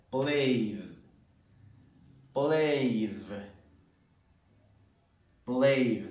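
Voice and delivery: "Played" as one long falling syllable; correct "Played". Shown as a demonstration. Teacher showing how it is pronounced, one-syllable "Played". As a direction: repeat "Played" slowly in one beat with a final d. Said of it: "para que ustedes vean cómo se pronuncia".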